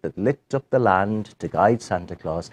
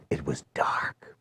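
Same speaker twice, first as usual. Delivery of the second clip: slow whisper of darkness; whispered dark; 9 semitones lower, 11 dB quieter, slower than usual. A narrator's reading. Storyteller whispering the dark night to the host. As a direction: breathe it like a secret.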